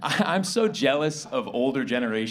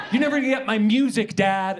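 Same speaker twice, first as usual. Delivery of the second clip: mock teen outburst, higher and louder; high school movie character; 8 semitones higher, 5 dB louder than usual. Movie teenager complaining to Dad, mock-aggrieved. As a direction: protest like a movie teenager, pitched up. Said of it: "movie about high school".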